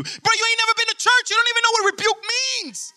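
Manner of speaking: high-pitched comic indignation; hammed-up squealing protest; exasperated retort. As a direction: fire back in disbelief, voice way up. Acted annoyed retort to an accuser.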